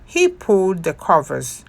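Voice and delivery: usual speaking voice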